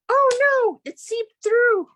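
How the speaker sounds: high, loud cry of dismay; dismayed wail. Real, not acted. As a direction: wail it as the mishap hits. Dismay at ink seeping through.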